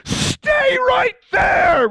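very high, drawn-out mock shout; hysterical command. Leader ordering teens to stay put, hysterical shouted command. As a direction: shriek each word slowly like an outraged chaperone.

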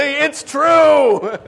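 high, loud words through a laugh. Real, not acted.

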